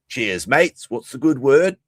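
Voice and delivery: hammed-up cartoon Australian accent; chirpy Simpsons-style Aussie bloke; mock Australian greeting. Mocking Australians, greets his mates in a cartoon Australian accent. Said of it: "perfect characterization of Australia".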